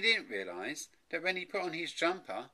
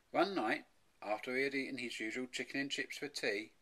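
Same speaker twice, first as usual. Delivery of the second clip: ordinary delivery, nothing marked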